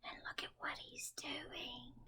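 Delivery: breathy hush, secretive; whisper. Acted. Teacher whispering to little listeners. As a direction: lean in and whisper it to the kids. Hushed aside to children at storytime.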